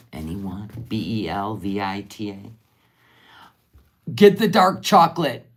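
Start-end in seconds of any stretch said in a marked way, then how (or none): 4.1-5.5 higher, louder eager push; urging. Real, not acted. Urging viewers to pick this flavor. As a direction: sell it hard, higher and louder.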